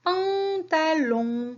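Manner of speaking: "pantalon" very slowly in three syllables; teacher sounding out "pantalon" for children. Shown as a demonstration. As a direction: stretch "pan", "ta", then a long "lon". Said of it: "la syllabe que j'entends".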